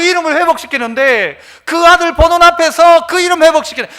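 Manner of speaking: loud, high, emphatic sermon voice; full-force preaching. Real, not acted. Preacher voicing God restoring his name to the congregation, full-force preaching. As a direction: drive each declaration home forcefully.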